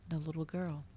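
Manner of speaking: usual speaking voice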